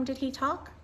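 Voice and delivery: usual speaking voice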